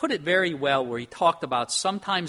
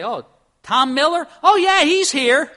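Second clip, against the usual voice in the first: mother telling truth; 12 semitones higher, 13 dB louder than usual. Retold or imitated. Mother tending bar telling the wife on the phone he is there, high and loud. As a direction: cheerfully give the man away.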